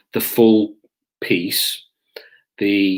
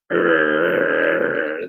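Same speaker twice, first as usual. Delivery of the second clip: long, low growling noise; imitated dog growl; 4 semitones lower than usual. Police dog with a grip on the suspect, growling. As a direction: growl like a dog gripping someone. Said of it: "growling dog".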